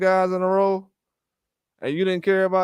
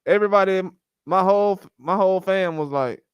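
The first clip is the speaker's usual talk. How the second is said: ordinary talk, nothing marked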